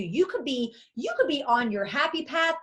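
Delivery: ordinary delivery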